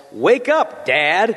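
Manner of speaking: a high shout cutting through a silent church; child's yell. Retold or imitated. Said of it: "as he yells".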